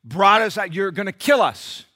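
loud, high angry accusation; heated loud charge; accusing Moses. Acted. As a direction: blame him with rising fury. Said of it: "They said".

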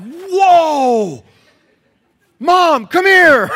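put-on high, loud boyish whoops of amazement; excited shout. Kids calling mom to the porch, loud excited shout. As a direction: yell for mom to come see.

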